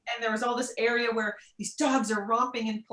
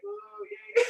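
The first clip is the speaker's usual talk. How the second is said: high little cheer; a tiny thrilled yay; excited squeal; 12 semitones higher than usual. Retold or imitated. After brushing her first Parisian dog, high little cheer. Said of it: "So excited".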